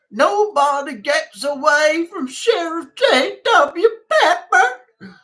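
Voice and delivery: hammed-up Smokey and the Bandit sheriff yell; Sheriff J W Pepper. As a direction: shout it like the furious sheriff in the film. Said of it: "Smokey and the Bandit".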